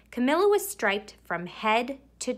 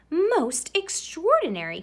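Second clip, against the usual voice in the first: higher, louder astonished exclaim; amazed doctor; 8 semitones higher, 3 dB louder than usual. Acted. Doctor examining striped Camilla, higher amazed exclaim. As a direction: marvel like a baffled old doctor. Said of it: "he exclaimed".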